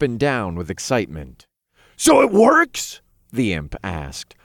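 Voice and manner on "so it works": excited. A narrator's reading. Imp jumping with excitement.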